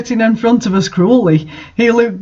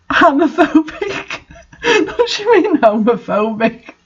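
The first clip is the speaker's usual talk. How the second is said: incredulous laugh; 8 semitones higher than usual. Real, not acted. Reader questions the author's claim, laughing high. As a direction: repeat the word in amused disbelief.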